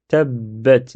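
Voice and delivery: "tabbat" slow, b held double; slow tab-bat for "tabbat"; doubled consonant. Shown as a demonstration. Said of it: "c'est comme si je dédouble la lettre ba".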